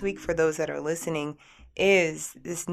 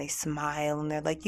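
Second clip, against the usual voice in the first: usual speaking voice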